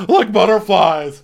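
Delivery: high, loud mock gush; gushing mimicry. Julia chiming in to Paige about her crush, mock gushing. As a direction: mimic an excited friend's squeal of agreement. Acting out an excited friend's reply.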